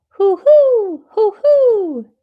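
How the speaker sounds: high, loud hooting; owl hoot. Acted. Teacher voicing the owl for the class, high hoots.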